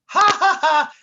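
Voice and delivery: high, loud cartoon guffaw; goofy laugh. Sidekick mocking his partner, goofy high laugh. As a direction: laugh like a dim buffoon. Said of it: "Dummerton laughed".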